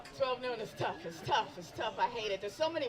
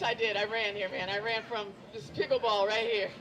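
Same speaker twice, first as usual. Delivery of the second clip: ordinary delivery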